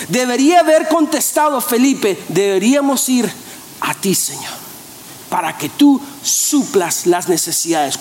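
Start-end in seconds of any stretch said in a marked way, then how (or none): 2.3-8.0 reverent answer. Acted. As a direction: hush into a humble answer to the Lord. Felipe debería haber respondido con fe. (respuesta reverente, baja y suave, como en oración)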